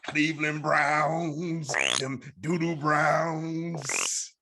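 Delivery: slow, drawn-out preacher cadence with a laugh; mock preacher. Pastor teasing Sal about the Browns, slow preaching drawl. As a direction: stretch each phrase like a sermon punchline.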